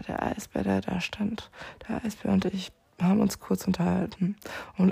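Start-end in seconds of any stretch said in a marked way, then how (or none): 0.1-1.5 whisper. Acted. Telling viewers about the polar bear in a whisper. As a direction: breathe it like a secret.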